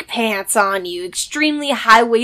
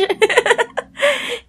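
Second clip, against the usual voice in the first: real high burst of laughter; 11 semitones higher than usual. Real, not acted. Laughs after surprise.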